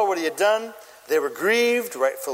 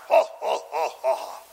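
mock villain laugh; 5 semitones lower than usual. Unmerciful servant gloats over jailed debtor, mock villain laugh. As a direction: fake a deliberate villain's laugh. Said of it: "And then he laughs".

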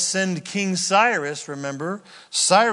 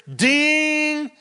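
high ding; 10 semitones higher, 4 dB louder than usual. Retold or imitated. Realization moment sound.

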